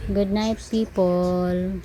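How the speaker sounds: slow, spelled-out good night to copy; slow, deliberate good night model; slow model line. Shown as a demonstration. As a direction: say it slowly for the baby to repeat. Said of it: "say good night".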